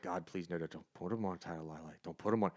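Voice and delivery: mild retold plea; pleading. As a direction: beg quietly. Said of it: "I was like".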